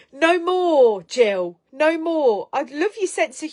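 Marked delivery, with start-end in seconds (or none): 0.1-1.6 playful loud no more; loud insistence. Real, not acted. Insisting viewer not go silent. 1.7-2.5 loud, high repeat of no more; playful firm no more; loud insistence again. Real, not acted. Insisting viewer not go silent.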